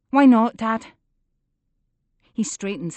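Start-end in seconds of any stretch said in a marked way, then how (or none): 0.1-0.9 loud, pressing demand. A narrator's reading. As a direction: push him for answer. Daughter demands answer.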